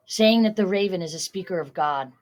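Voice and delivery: plain reading voice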